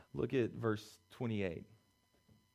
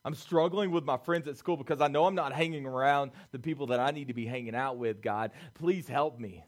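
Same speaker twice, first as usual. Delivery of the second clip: raised, quick earnest confession; angry teen's prayer; 3 semitones higher, 10 dB louder than usual. Retold or imitated. Preacher voicing a teen confessing anger, mildly raised. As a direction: rush out the struggle to God. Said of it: "That's what Samson's saying".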